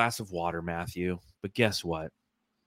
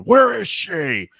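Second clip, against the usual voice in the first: higher, louder, slow Batman call; 10 semitones higher, 9 dB louder, much slower than usual. Retold or imitated. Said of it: "just going".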